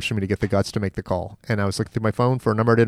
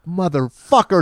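higher Motherfucker, rising; mock shocked curse; 7 semitones higher, slower than usual. Retelling the prank call reveal, higher shocked curse. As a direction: Motherfucker flung up in sudden realization. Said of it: "And I was like".

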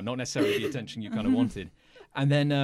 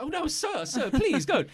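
high, begging Mike voice; pleading Mike; 12 semitones higher, 4 dB louder than usual. Acted. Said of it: "Honest Mike is, uh, going like".

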